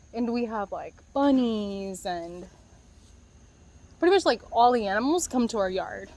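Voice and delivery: ordinary delivery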